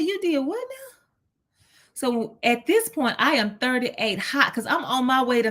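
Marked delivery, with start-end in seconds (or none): 0.0-1.0 high, disbelieving question; can't believe what he just said; incredulous. Retold or imitated. Audiologist admits leaving it broken.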